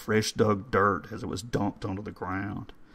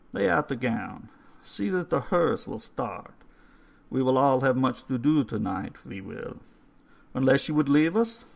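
put-on old caretaker voice; 4 semitones higher than usual. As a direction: read the stone like a courteous old man. Old man instructs Raymond.

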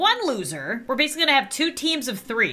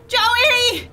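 higher, louder cries of dismay; distraught yelling; 10 semitones higher, 4 dB louder, much slower than usual. Real, not acted. Gamer yelling at her Sim who just lost, distraught. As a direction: yell at the screen in disbelief. Joey caught moving in the game.

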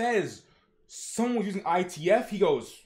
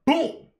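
loud, high boom sound effect; imitated boom; 10 semitones higher, 7 dB louder than usual. Narrator sound-effects the story, loud punchy boom.

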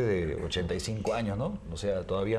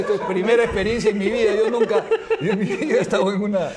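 excited; 10 semitones higher, 8 dB louder than usual. Real, not acted. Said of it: "excited telling own".

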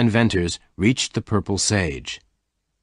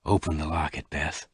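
gently; 4 semitones lower, 6 dB quieter than usual. A narrator's reading. Lassiter handing Bess the locket, gentle and soft. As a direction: lower and softer, tender. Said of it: "Lassiter said gently".

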